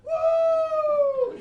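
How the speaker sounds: high, loud whoop; one big high excited cheer; hype shout. Retold or imitated. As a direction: whoop like a star taking the stage. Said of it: "He's just like".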